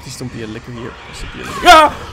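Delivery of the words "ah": very high loud shriek; real jump-scare scream; startled scream. Real, not acted. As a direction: scream in sudden fright. Licker jumps out.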